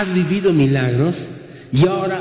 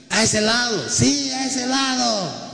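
high, stretched-out call without full volume; calling from afar; 8 semitones higher, 3 dB quieter, slower than usual. Acted. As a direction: imitate someone directing a boat from shore. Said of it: "A ver, ¿cómo ha dicho?". (llamado agudo y alargado)

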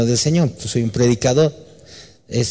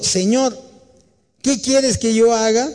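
high, pleading, fearful question; trembling and fearful; 11 semitones higher than usual. Acted. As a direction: plead up high like a frightened man. Trembling Saul asks the Lord.

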